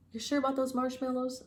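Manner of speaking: put-on high eager young lion; lightly voiced young lion.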